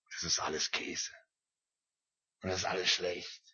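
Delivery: a worn-out sigh of a Monday voice; gloomy whisper. Acted. A worker at his Monday workbench grumbles to himself in a soft, voiceless whisper. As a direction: whisper it, low and soft, fed up.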